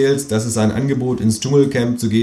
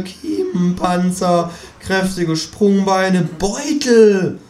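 kangaroo voice; 10 semitones higher than usual. A narrator's reading. Kangaroo lectures on nature, high and smug. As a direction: savour the list like a know-it-all kangaroo.

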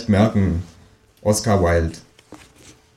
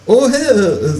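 high, louder startled kangaroo; surprised kangaroo; 13 semitones higher, 4 dB louder than usual. Acted. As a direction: caught off guard, squeak it out. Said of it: "sagt das Känguru überrascht". (überraschtes Känguru mit hoher Stimme)

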